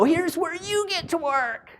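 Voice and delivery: high, slow and grand, like a host opening a door; welcoming announcement. Retold or imitated. To new laundry hires, a high slow grand welcome. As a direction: throw the door open and proclaim it. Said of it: "go swing that door open and go".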